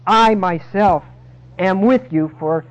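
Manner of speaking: loud, slow stress on myself and with; emphatic. Acted. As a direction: hammer each word home.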